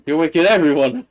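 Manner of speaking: loud, high mock scolding with a laugh; mocking echo. Laughingly echoes the other's scolding.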